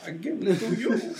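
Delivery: put-on supervillain voice, pitched high; Bane. Mocking the wrestler's pandering for the co-host, Bane accent. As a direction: climb high on a hammed-up villain's proclamation. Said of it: "work in my Bane accent".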